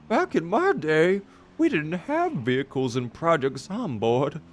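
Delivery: weak old man impression; grandpa voice. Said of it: "that was not a good grandpa voice at all".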